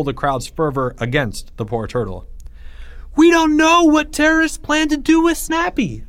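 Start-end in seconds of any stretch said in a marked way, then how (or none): 3.2-6.0 very high, loud outcry; crying out. A narrator's reading. Leo at the rally outside the room, crying out. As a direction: cry it out to a crowd of protesters. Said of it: "Leo cried out".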